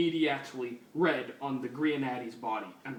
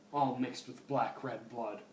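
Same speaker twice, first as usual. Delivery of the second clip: ordinary talk, nothing marked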